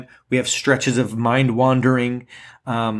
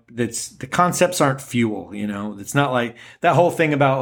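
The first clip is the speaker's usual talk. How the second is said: ordinary talk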